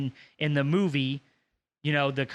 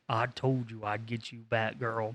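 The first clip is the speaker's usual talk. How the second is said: low gloating villain; 4 semitones lower, 6 dB quieter, slower than usual. Retold or imitated. Villain gloating while beaten.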